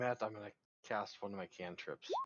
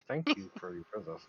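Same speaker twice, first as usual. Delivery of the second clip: higher, louder forced courtesy; forced politeness; 7 semitones higher, 5 dB louder than usual. Acted. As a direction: bite it out with fake politeness. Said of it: "grit through my teeth".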